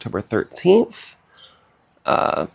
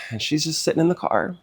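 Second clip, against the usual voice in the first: normal talking voice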